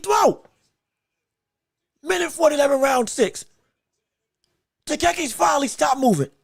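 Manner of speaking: ordinary talk, nothing marked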